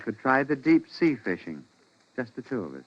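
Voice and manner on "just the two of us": sinister quiet. Acted. Husband plotting, soft.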